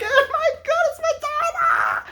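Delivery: very high screaming, laughing and sobbing with joy; hysterical fan shriek. Retold or imitated. Comic mimicking the blonde host screaming over [name]. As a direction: lose it completely like a crazed fan. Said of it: "That's like".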